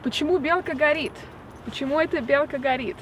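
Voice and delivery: "Почему белка горит?" spoken in Russian, pet-CHEE-moo BYEL-ka ga-REET; Russian phrase. Shown as a demonstration. Teaching viewers a Russian phrase, clear Russian. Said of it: "how you say it in Russian is".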